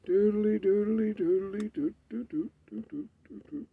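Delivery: high, dopey sing-song doodling; airhead humming. Pretend. Playing dumb in front of the ranting guy, high dopey doodling. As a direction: hum like your brain is empty. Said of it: "I'm going, in my mind".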